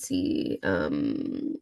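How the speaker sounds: hoarse cracking voice under the weather. Real, not acted. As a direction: voice breaking, sick and hoarse. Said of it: "why is your voice crackling".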